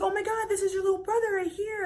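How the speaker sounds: high, quick excited squeal; excited introduction. Retold or imitated. Grandmother introduces the little brother, high and excited. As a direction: imitate a thrilled relative showing off family.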